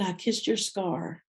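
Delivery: soft, slow, gentle quote; husband's soft words recalled with care; tender. Retold or imitated. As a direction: say it quietly, with tenderness. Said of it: "and he said".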